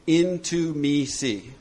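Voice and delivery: "Into me see" spoken very slowly, word by word; wordplay split. Shown as a demonstration. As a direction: spell out "Into me see" as three slow words. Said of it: "there's intimacy".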